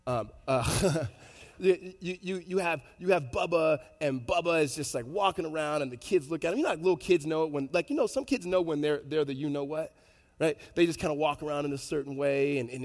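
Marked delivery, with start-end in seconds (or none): none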